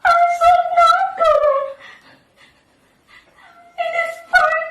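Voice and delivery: put-on high, fast frightened kid voice; scared boy. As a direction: whimper like a scared little boy. Willie frightened by future.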